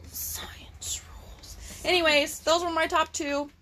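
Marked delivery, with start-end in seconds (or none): 0.1-1.8 hushed catchphrase, almost no voice; whispered. Pretend. Whispering the show's catchphrase to her friend. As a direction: breathe it like a secret.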